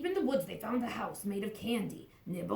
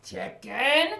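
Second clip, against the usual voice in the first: ordinary delivery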